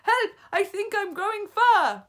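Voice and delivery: put-on high, loud panicked boy; boy screaming to his classmate. A narrator's reading. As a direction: shriek for help.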